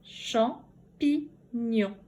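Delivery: "Champignon" in slow separate beats, cham, pi, gnon; syllable by syllable. Shown as a demonstration. Teacher recapping for pupils, "Champignon" slowly broken in three. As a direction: pause after each syllable of "Champignon". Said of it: "dans la troisième syllabe".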